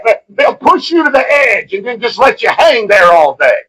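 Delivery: ordinary talk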